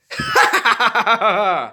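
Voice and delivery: loud, high jeering cackle; mocking laugh. A narrator's reading. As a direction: laugh at them to mock them. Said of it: "He then laughed mockingly".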